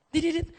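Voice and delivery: high, soft electronic beeping; imitated phone beeps. Pupil on phone during maths, high beeping noises. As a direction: chirp little high beeps.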